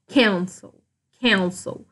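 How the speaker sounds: "cancel", strong first syllable and weak ending; stress on first syllable. Shown as a demonstration. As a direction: say "cancel" with the weight on "can". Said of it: "minha sílaba mais forte é o can".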